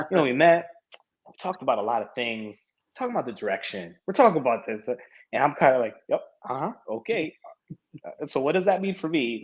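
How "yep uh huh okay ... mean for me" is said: higher, hesitant retold prompting; impatient. Athlete waiting for the team's decision, mock impatience. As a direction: imitate nudging someone toward the point.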